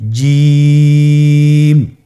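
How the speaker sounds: জিম held long and loud; drawn-out Jim. Shown as a demonstration. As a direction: stretch জিম with full voice. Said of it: "আপনারা আমার সাথে বলুন". (তিন আলিফ টেনে জিম)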